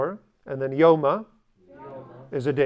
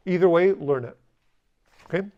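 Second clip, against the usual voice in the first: usual speaking voice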